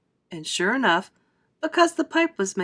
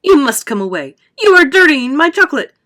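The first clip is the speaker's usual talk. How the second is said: loud protest climbing higher; crying out; 7 semitones higher, 12 dB louder than usual. A narrator's reading. Wonka cries out that his chocolate is being dirtied. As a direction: cry out in rising protest. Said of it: "cried Mister Wonka".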